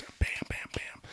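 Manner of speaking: quick hushed whisper of imagined shots; fast whisper, almost silent on the last bams. Retold or imitated. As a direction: breathe the shots out under your breath. Imagining shots in his mind.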